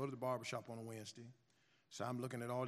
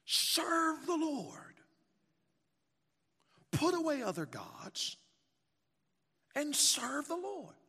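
whooping preacher; 14 semitones higher, 7 dB louder, much slower than usual. Acted. Preaching climax.

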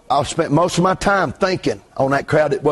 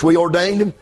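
ordinary delivery

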